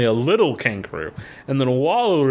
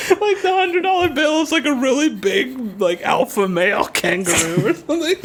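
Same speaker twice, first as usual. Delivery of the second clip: high giggly voice, cracking up mid-sentence; words bubbling with laughter at the joke; laughing; 13 semitones higher than usual. Real, not acted. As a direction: talk through real laughter. Giggling at own kangaroo joke.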